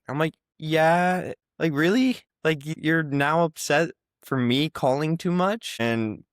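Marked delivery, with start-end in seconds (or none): 0.6-5.8 high-pitched, put-out comeback; retold indignant disbelief. Daughter to mom over calling too much, retold indignant disbelief. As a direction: replay being taken aback and offended.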